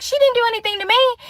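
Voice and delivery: very high-pitched, incredulous comeback; defensive protest. Retold or imitated. Defending herself to friend, high defensive protest.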